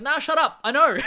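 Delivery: high, loud brush-off; mock defiant retort. Boy brushing off his doubting family, loud mock defiance. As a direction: shut them down like a stubborn kid, playfully.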